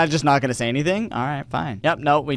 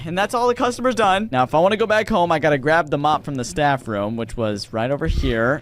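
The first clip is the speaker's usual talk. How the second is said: ordinary delivery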